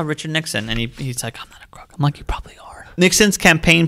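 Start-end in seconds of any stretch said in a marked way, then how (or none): 1.4-1.9 barely voiced, very soft imitation; hushed mock quote. Retelling a Nixon sighting at an art gallery, hushed mock quote. 2.2-2.7 very soft, whispered aside; hushed retort. Retold or imitated. Recalling a reply to Nixon at the gallery, hushed retort.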